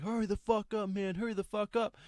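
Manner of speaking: high, urgent mock yell; retold scream, high and insistent; imitated screaming. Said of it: "this guy's screaming at me".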